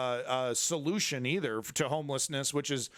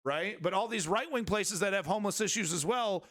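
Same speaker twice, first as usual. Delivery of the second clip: ordinary talk, nothing marked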